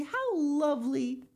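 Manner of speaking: high, drawn-out delight; delighted admiration. A narrator's reading. Lucy congratulates Miss Pinchin, delighted admiration. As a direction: gush slowly in a high voice.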